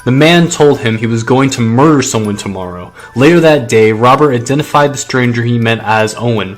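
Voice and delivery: loud, dramatic true-crime read over music; booming documentary narrator; story narration. Acted. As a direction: narrate the mystery with big projected weight.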